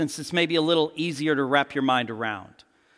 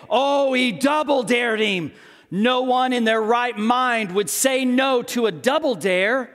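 higher, slow, drawn-out narrator marvelling; movie narrator; 6 semitones higher, 3 dB louder than usual. Acted. Movie narrator reacting to a double dare, drawn-out amazement. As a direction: play the narrator, stretching it in amazement. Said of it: "then the narrator stops for a second".

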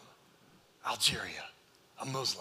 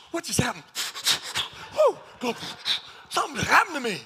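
higher and louder, overwhelmed wonder; amazed convert; 9 semitones higher, 6 dB louder, faster than usual. Retold or imitated. New convert telling the pastor he is stunned by what happened, excited amazement. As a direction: blurt it out stunned and thrilled. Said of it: "That brother was like".

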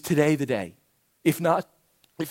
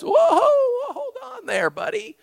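high, laughing "whoa, hold on there, buddy"; amused mock deity, high and laughing; mock God voice. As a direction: play God chuckling at a bargainer, pitched way up. God voiced in retold bargaining.

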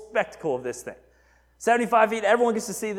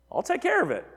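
high-pitched mock wife voice; lightly voiced scheming queen, pitched up; Jezebel's reassurance; 7 semitones higher, faster than usual. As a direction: imitate a wife breezily taking charge. Voicing Jezebel answering her husband.

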